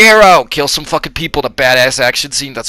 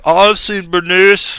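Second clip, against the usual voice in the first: high character voice; 9 semitones higher than usual. Retold or imitated. Villager voiced in retelling.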